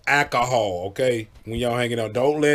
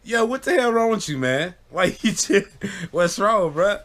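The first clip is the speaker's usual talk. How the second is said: baffled; 7 semitones higher than usual. Retold or imitated. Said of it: "we always be like".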